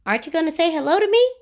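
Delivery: a playful goblin pestering someone. Acted. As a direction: rattle it off like a cheeky goblin. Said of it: "asked the goblin".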